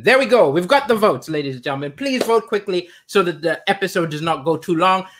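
normal talking voice

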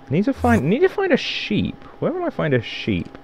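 high singsong musing; sing-song. Pretend. Gamer musing high about finding a sheep. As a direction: lilt it up like a little tune.

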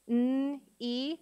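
N. E. as held loud nnn, then ee; sounded out. Shown as a demonstration. Teacher sounding out a syllable, N. E. as two sounds. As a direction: separate the sounds of N. E. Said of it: "Let's sound out each syllable".

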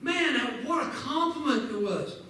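usual speaking voice

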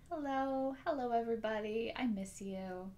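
soft, sweet greeting; as nice as possible. Shown as a demonstration. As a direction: greet the children gently and kindly. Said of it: "as nice as we".

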